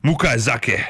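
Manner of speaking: careful attempt at Japanese; Japanese accent. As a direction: sound out the word in a Japanese accent. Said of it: "get better at these accents".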